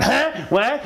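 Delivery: high, startled questioning; hint of a wary woman caught off guard; lightly voiced suspicious neighbour. Suspicious neighbour answers a stranger.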